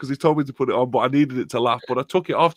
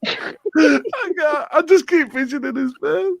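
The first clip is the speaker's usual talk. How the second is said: higher, half-crying laughter; helpless laughing; 10 semitones higher than usual. Real, not acted. Telling Dino the story, crying with laughter. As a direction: try to go on but keep dissolving. Laughing too hard to continue.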